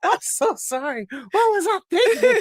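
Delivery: new mother wails sorry to her own mom; mock crying. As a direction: wail it out, half laughing. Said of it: "I was like".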